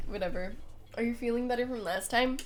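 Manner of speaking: ordinary talk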